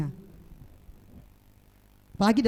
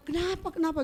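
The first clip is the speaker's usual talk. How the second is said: grandma worried; 7 semitones higher, 3 dB quieter than usual. Retold or imitated. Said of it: "Langsung dia mani".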